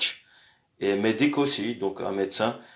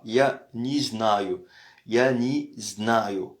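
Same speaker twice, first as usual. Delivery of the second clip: я не знаю spoken slowly, word by word; slow demo; much slower than usual. Shown as a demonstration. Teacher to students, slowly modelling я не знаю. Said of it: "teacher demonstrating phrase".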